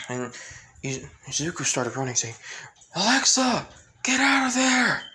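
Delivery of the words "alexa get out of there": high urgent yell to a friend in danger; warning shout. Acted. Izuku yells at Alexa to flee, high urgent shout. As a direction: shout the warning across the street, much higher and louder.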